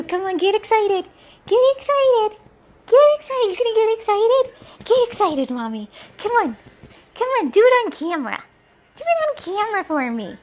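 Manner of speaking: excited pet talk. Real, not acted. Owner urging her shy dog to get excited, high excited baby talk.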